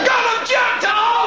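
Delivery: high, hammed-up yell; mock frantic shout. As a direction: shriek it like a man gone wild. Mock violent self-exclamation.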